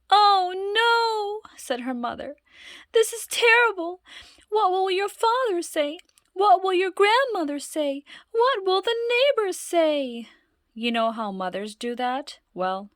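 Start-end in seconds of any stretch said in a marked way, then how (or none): 0.1-10.3 high, loud wailing fret; fretting mother. Acted. Mother fretting over what everyone will say, high and wailing. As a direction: wail like a fussing mother.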